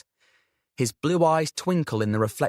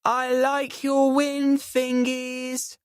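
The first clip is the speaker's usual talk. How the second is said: put-on slow, much higher, slurred drunk call; tipsy friend calling back to the stallholder, slurring; 13 semitones higher, much slower than usual. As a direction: drag the words out like you've had too much mulled wine. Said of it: "in her slurred voice".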